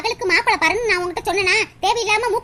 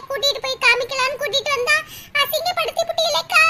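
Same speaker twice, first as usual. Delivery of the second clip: hurt complaint; 4 semitones higher than usual. Acted. Insulted neighbour complains.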